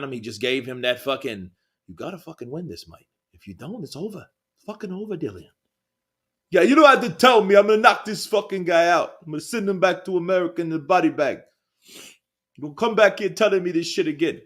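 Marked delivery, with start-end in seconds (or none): 1.9-5.5 promoter's quiet fierce urging; hushed corner pep talk. Retold or imitated. Eddie Hearn urging Whyte before fight. 6.5-14.4 high, loud, fast put-on British fighter accent; hammed-up British accent, fast and fired up; mock British accent.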